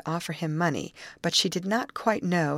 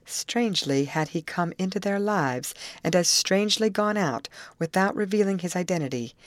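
ordinary delivery

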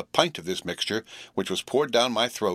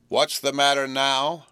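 slower, sharper repeat of the question; more sharply; 5 semitones higher, 4 dB louder, slower than usual. A narrator's reading. Sister repeats her question to Joe, sharper and slower. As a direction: lean on each word, losing patience. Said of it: "more sharply than before".

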